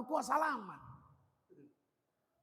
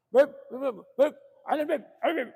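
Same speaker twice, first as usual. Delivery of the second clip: mock eager kissing sounds; 5 semitones higher, 8 dB louder than usual. Mocks people crowding the habib.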